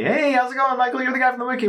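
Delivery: high-pitched imitation of someone hailing you; lightly acted, bright-pitched hello in another person's voice; friendly greeting, imitated. Guest greeting a wiki host at a convention, imitated friendly hail.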